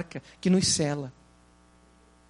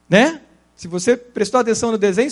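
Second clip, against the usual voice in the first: ordinary delivery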